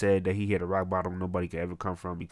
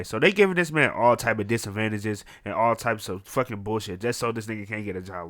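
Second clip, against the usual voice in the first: normal talking voice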